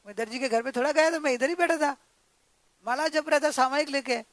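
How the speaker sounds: acted-out angry protest, pushed along quickly; angry father-in-law. Father-in-law scolds his daughter-in-law for lying, angry. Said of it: "सामने गुस्से में है".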